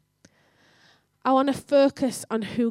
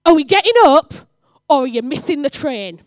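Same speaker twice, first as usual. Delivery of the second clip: loud demand; 3 semitones higher, 11 dB louder than usual. Retold or imitated. Rousing Jenny to catch the train, in an urgent shout. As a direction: holler it at someone still in bed.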